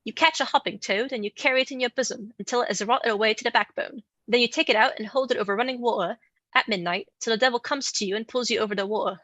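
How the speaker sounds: a put-on English accent reading a quote; American trying an English accent for a witch's account. Shown as a demonstration. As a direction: read it in your best English accent. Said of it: "I'm gonna give the English accent my best shot".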